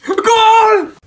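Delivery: excited drawn-out cheer; goal celebration. Acted. Boy scoring in his video game, excited. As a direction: cheer out loud for the score.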